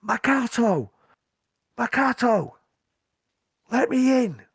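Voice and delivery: high, loud shouted name and plea; urgent shouting. Acted. Rider answering the guard at the gate, shouting urgently. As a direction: holler up to be let through the gate. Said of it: "starts shouting".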